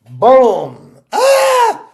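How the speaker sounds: loud boom then high yelp; gunshot and cry. Retold or imitated. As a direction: shout the shot and the scream. Shooting scene, cry heard.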